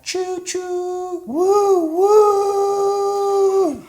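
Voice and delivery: high whooping choo choo whistle; train noise. Shown as a demonstration. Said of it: "You could be like this".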